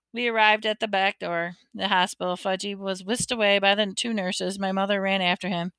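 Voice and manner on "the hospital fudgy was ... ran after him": ordinary delivery, nothing marked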